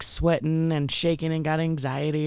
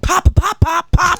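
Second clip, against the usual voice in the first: high, loud pop pop gunshot sounds; imitated gunshots; 12 semitones higher, 7 dB louder than usual. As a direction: mimic the shots as quick pops. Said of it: "Describe what you heard." And I was like".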